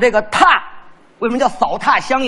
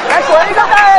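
calling a dog; 10 semitones higher, 5 dB louder than usual. Acted. Commands his dog.

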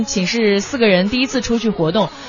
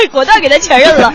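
laughing; 4 semitones higher, 6 dB louder than usual. Real, not acted. Still laughing.

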